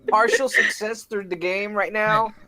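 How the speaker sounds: high loud disbelieving cry; exasperated disbelief. Real, not acted. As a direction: shout it in shock at the dice. Exasperated outburst at dice rolls.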